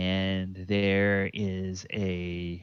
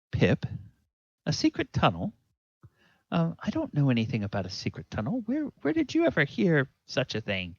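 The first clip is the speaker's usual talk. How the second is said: higher, quick and incredulous; a mother hearing this for the first time; surprised mother; 6 semitones higher, much faster than usual. Acted. Farm woman questioning Pip about the tunnel, higher and incredulous. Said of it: "she looks at Pip and she says".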